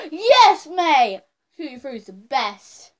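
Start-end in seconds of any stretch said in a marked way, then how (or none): 0.1-1.2 high loud joyful cheer; excited cheer. Real, not acted. Loves the Tutti Frutti bean.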